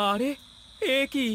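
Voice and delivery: suddenly blind, rush the question; panicked confusion. Acted. (হঠাৎ অন্ধ হয়ে আতঙ্কিত প্রশ্ন)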